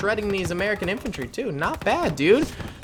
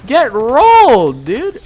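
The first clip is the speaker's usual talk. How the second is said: loud, slow, stretched "rolled"; drawn-out taunt; 5 semitones higher, 9 dB louder, much slower than usual. Real, not acted. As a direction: drag out "rolled" in triumph. Taunting a destroyed tank.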